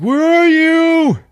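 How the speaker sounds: high, loud, drawn-out yell; hammed-up searching shout; desperate calling. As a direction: holler slowly through a haunted house. Husband searching for his wife.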